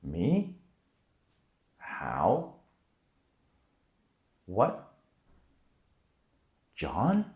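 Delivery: "Me? How? What? John?" each sweeping up at the end; questioning rise. Shown as a demonstration. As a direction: ask each word separately with an exaggerated rising pitch. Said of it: "if you're asking a question".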